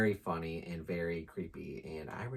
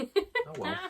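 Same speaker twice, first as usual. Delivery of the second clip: laughing; 4 dB louder than usual. Real, not acted. Laughing after a pun.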